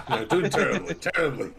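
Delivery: laughing through the words; giggling while admitting defeat. Real, not acted. Laughing at own bad score.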